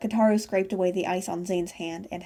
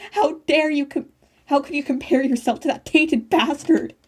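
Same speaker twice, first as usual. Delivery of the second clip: higher, louder shriek at brother; screaming; 6 semitones higher, 5 dB louder than usual. A narrator's reading. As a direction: scream it in fury. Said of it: "she screamed".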